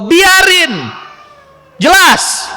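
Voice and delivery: firm verdict to the husbands; emphatic shout. Real, not acted. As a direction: hit each word hard and final. Preacher's emphatic answer.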